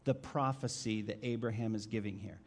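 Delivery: usual speaking voice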